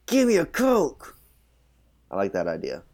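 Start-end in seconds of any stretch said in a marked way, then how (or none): 0.1-1.2 high, slow, drawn-out demand; menacing Ian demand. Retold or imitated. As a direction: stretch each word up high like a bullying rocker. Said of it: "And he's like".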